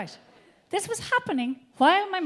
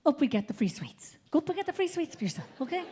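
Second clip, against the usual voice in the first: whispering mother; 8 dB quieter than usual. Retold or imitated. Mother urging child.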